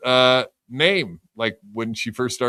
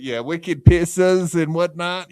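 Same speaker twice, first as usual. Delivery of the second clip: higher, mock-Boston jeering; Boston accent; 7 semitones higher than usual. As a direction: put on a hammed-up Boston accent. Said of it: "the Boston lady accent".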